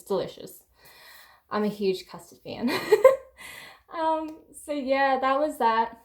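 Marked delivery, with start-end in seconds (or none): 2.8-3.7 sudden high-pitched giggle. Real, not acted. Laughing about her custard love. 3.9-6.0 laughing. Real, not acted. Still laughing after custard joke.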